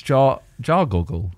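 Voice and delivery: slow careful. Shown as a demonstration. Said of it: "Do you want me to say it in English?".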